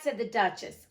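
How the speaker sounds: ordinary delivery, nothing marked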